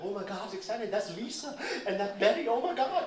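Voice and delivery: high, breathless crowd chatter; high thrilled onlookers; excited crowd. Retold or imitated. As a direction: gush like neighbors watching the shoot. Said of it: "everybody's like".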